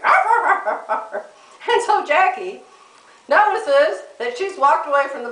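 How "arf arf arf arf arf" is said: imitated barking. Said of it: "barking at me".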